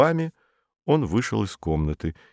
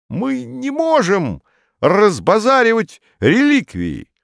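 high, slow officious voice; stuffy official protesting; pompous bureaucrat; 4 dB louder than usual. A narrator's reading. Modest, pompous administrator.

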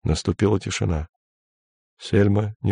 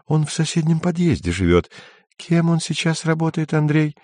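higher, coquettish woman's voice; 9 semitones higher than usual. A narrator's reading. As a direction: play her, lightly seductive. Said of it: "улыбаясь обольстительно". (кокетливо, повыше)

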